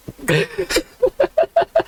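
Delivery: high wheezing giggle; breathless high laughter; helpless laughing. Real, not acted. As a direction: crack up for a couple of seconds. Reader cracks up at the line.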